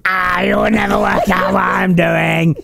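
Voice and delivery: slow, loud booming villain shout. Retold or imitated. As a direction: boom it out like a movie villain. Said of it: "And go".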